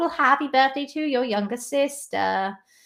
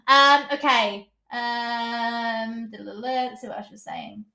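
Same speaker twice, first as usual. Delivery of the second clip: normal talking voice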